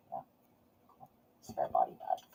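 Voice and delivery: low whispered aside to herself; whispered musing. Real, not acted. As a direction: breathe it like a private joke.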